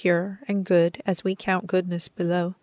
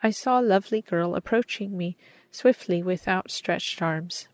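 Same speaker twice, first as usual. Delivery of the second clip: usual speaking voice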